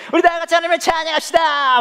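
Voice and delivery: high shouted call to praise; rally the crowd to praise God; worship leader shout. Retold or imitated. Preacher mimicking the worship leader rallying the crowd, high shout.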